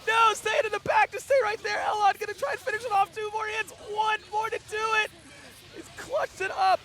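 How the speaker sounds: very high, loud hype screaming; esports caster. Real, not acted. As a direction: scream every hit like the final round. Caster screams through final hits.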